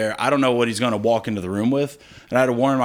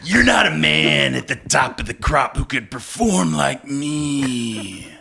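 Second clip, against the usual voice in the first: wrestling promo; 2 semitones higher than usual. Retold or imitated. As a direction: cut a promo like a pro wrestler. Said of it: "deliver it like a wrestling promo".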